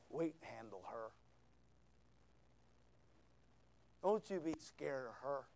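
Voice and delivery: low, soft reassurance; God's quiet voice. Retold or imitated. God telling Elijah not to be scared, low and quiet. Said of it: "you know what God said to Elijah".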